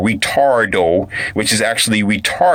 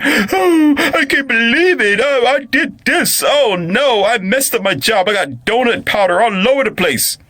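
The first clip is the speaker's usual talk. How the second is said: very high mock wail; hammed-up tearful confession, squealed high; fake crying; 13 semitones higher than usual. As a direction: blubber theatrically to cover your tracks. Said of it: "is to cry, to be emotional".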